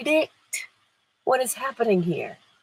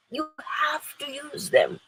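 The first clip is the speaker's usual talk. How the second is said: slow higher insistence; 7 semitones higher, 4 dB quieter, slower than usual. Real, not acted.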